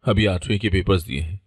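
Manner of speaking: ordinary delivery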